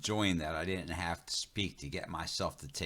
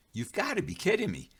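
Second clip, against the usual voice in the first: high, exasperated disbelief; you can't be serious; 12 semitones higher, faster than usual. Acted. Narrator reacting to Running Bear's name story in high disbelief.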